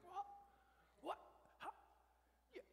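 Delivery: high, loud incredulous sputter; astonished disbelief. Acted. Preacher acting out shock at a new believer's insight, astonished disbelief.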